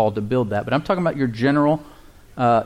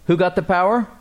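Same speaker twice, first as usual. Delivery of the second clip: normal talking voice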